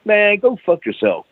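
retold irritated snap at the phone; mock annoyed. Hanging up on prank caller.

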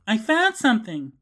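high, loud mock yell. A narrator's reading. Narrator voicing Sheena calling to the others, high yell. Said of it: "She yells".

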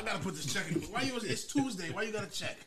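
high, loud mimicry of the fussy old man; mocking old partner. Mocking the partner's check ritual, a high loud fussy impression. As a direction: lampoon him, all fuss and bother.

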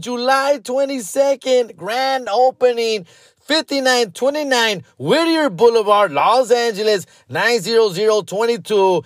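high-pitched, punchy radio-commercial pitch; hammed-up ad read. Host plugging an East L A grand opening, hammed-up ad read. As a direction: sell it like a local grand-opening spot, stretching key words.

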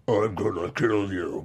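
a hint of a big brute threatening; menacing villain. Imagined voice for the big enforcer, low menace. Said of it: "he looks like he'd be like".

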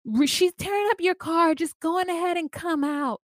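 high-pitched mock coaxing; high, hammed-up calling up to someone; imitated friend calling. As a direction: call him out in a high voice. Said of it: "Renee's like".

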